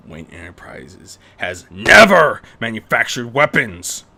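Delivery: loud, indignant outburst; hot retort. Acted. Bruce defending his company to his daughter, hot and loud. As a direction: fire it back hotly. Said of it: "Bruce replied hotly".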